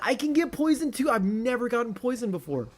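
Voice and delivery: high loud disbelief; shocked. Real, not acted. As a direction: yelp in real surprise. Surprised by poison in game.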